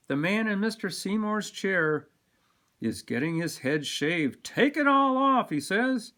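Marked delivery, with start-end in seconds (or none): 4.4-5.5 high, loud, hearty order; calling out. A narrator's reading. Customer getting his head shaved, calling out boldly. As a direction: sing out the order to the barber.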